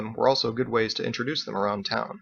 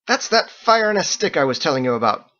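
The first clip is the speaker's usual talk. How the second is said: excited Fergus; 6 semitones higher, 5 dB louder than usual. A narrator's reading.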